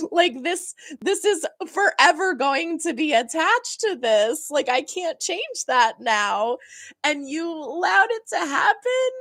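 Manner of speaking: high, exasperated mock protest; pissed off. Confronting brother who allowed the wedding planner, pissed-off. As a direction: keep the imagined outrage pitched high. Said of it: "I would be pissed".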